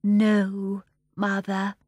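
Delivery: meek, hushed reply; meek daughter. A narrator's reading. Giant daughter answering her mother, meek. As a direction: answer meekly to a stern mother. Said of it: "said Miss Fanny meekly".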